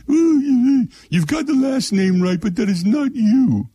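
very high, slow, drawn-out cook voice; Julia Child impression. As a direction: mimic Julia Child, stretching the words up high. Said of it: "That's the way she talked".

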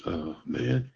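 muttered under the breath, cocky and low; a young man's quiet swagger; cocky boast. Retold or imitated. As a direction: brag to yourself half-whispered. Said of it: "I was so full of myself".

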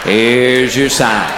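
low, slow deadpan; slow low deadpan tag line; catchphrase punchline. Acted. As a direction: draw it out low and dry.

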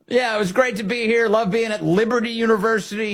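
high-pitched mimicry of a thankful guest; mock gushing. Host mimicking the man thanking Liberty University, mock gushing. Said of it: "he says".

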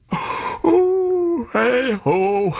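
big sleepy yawn, voice sliding high. Acted. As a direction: yawn the words out drowsily. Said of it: "I'm yawning again".

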